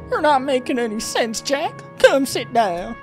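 loud high falsetto, urging; concerned woman character. Acted. Lily telling Jack to sit down, loud falsetto. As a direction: put on a high, pushy female voice.

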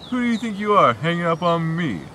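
ordinary talk

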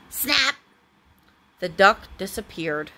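ordinary delivery

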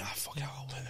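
private whispered aside to himself; whispering. Retold or imitated. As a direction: whisper it like a sneaky thought. Retold thought before calling work.

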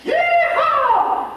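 very high, loud cowboy whoop; joyful whoop. Acted. Cowboy whooping after his sweetheart says yes. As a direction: let out a wild yell of joy. She agrees to marry him.

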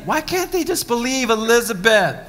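normal talking voice

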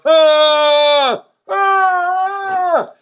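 long, loud, high mimicked moan; drawn-out high cry, acted; imitated winded wail.

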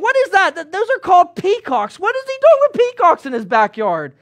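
high, incredulous; baffled by the strange bird; bewildered question. Acted. Townsfolk gawk at the peacocks, high and baffled.

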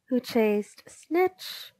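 ordinary delivery, nothing marked

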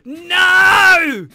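loud, high cry of alarm; big mock-desperate outcry; dramatic protest. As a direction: plead loudly on his behalf. Dramatic alarm for the obsolete projector.

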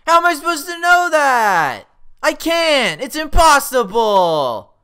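high, very loud gamer rage; full-force exasperated yelling; screaming in frustration. Real, not acted. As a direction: scream in outraged protest, drawing out the end. Raging at losing the game.

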